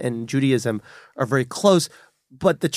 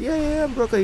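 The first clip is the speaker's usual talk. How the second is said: high, softish, rattled-off yeses; hasty agreement; much faster than usual. Retold or imitated. Man claiming Brooklyn to a stranger, high hasty agreement. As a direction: imitate going along eagerly in a high voice.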